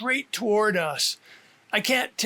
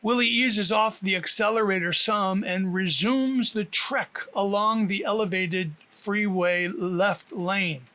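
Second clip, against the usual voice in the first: ordinary delivery, nothing marked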